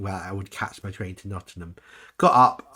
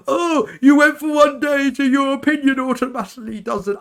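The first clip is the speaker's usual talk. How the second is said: mocking critic; 10 dB louder than usual. Commenters sneering at her one-day review in a hammed-up high shout. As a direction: ham up a scornful commenter. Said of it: "so many people, like, going".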